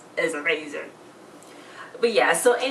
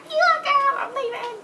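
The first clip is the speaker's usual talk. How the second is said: much higher, loud mock sass on "You girl"; high mocking kid voice; 4 dB louder than usual. Little brother teases older boy as he leaves, high mocking squeak.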